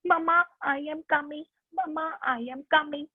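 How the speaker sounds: put-on high-pitched baby duckling voice; duckling calling mama. Duckling following its mother, high childlike call. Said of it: "Wherever the mama is going".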